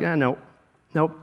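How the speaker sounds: hint of a sulky doubter; stubborn Thomas. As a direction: dig in quietly, won't budge. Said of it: "Thomas is like".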